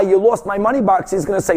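ordinary delivery

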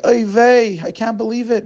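mock crying lament. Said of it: "He starts crying".

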